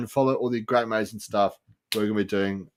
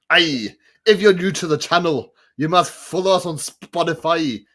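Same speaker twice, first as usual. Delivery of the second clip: higher-pitched, hammed-up Scottish accent; Alan Cumming Scottish accent; 5 semitones higher, 4 dB louder than usual. Shown as a demonstration. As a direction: put on a theatrical Scottish host voice. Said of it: "This is my Scottish accent".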